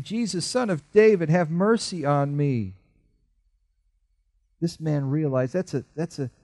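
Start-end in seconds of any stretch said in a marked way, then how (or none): 0.0-2.7 high pleading cry. A narrator's reading. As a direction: call out desperately for mercy. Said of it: "And he cried out".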